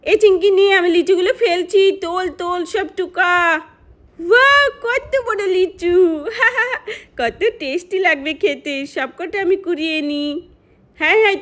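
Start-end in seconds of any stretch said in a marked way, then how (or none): 4.2-5.9 excited wow. Acted. Child delighted at big lychees. 6.0-7.1 put-on high, slow child's giggle of joy; delighted giggle. A child giggling with glee over the big lychees.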